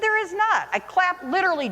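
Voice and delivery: high, insistent denial; protesting to a senior boy that she claps the light on; indignant disbelief. Retold or imitated. Teacher indignantly insisting to a student.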